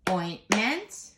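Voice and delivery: "ointment" in two separate beats, rising at the end; syllables checked. Shown as a demonstration. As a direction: beat out "ointment" as oint, ment. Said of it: "double check all of our sy-, syllables".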